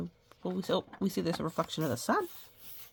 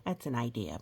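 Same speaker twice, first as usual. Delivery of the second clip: ordinary delivery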